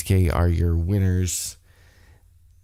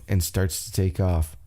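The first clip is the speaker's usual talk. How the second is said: ordinary delivery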